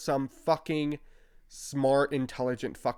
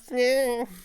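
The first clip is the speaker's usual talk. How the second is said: mocking cackle; 10 semitones higher, 3 dB louder than usual. Mock laugh after the quote.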